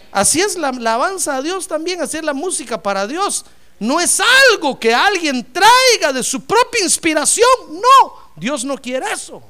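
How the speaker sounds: high, forceful pulpit delivery; emphatic preaching. Real, not acted. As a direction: build into loud, insistent preaching.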